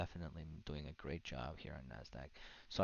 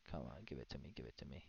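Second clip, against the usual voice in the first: low, soft muttered pleading; muttering; 4 semitones lower, 10 dB quieter, faster than usual. Real, not acted. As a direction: mutter under your breath at the screen.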